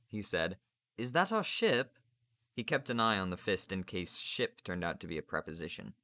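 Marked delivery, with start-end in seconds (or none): 1.0-2.0 put-on high, slow boy's question; cautious high-voiced question; tentative boy. A narrator's reading. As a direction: ask carefully in a higher child's voice. Boy timidly questions stern master.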